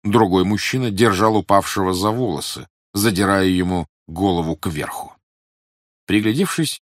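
ordinary delivery, nothing marked

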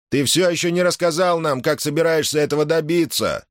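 high-pitched discontented grumble; grumbling. A narrator's reading. Someone in the crowd challenges Gar, grumbling in a high voice. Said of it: "проворчал кто-то".

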